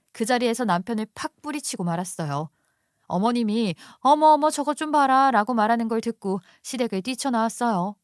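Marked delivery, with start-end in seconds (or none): 4.0-5.3 high, loud gasping-at-the-nerve outburst; shocked mother-in-law crying out; scandalized exclamation. A narrator's reading. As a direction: exclaim aghast, raised and louder. Mother-in-law exclaims at daughter-in-law's act.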